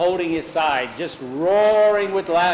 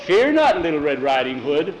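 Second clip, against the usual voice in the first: heroic woodman. Acted. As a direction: declaim like a school-play hero. Stage woodman reassures heroically.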